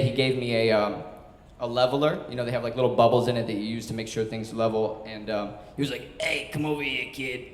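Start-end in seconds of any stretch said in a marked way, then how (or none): 6.2-7.5 put-on throaty New York accent, softly thrown out; imitated New York boss. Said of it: "he talked like he had a New York accent stuck in his throat".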